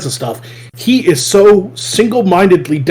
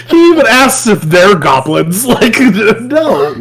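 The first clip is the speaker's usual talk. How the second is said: laughing incredulously; 8 semitones higher, slower than usual. Real, not acted. Fan retelling the hero's absurd question, laughing aloud.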